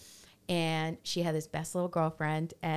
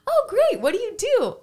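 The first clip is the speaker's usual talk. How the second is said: high, loud, chirpy question; high chirpy question from the other mom; bright eager question; 9 dB louder than usual. Retold or imitated. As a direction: chirp it, pitched way up. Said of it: "She goes".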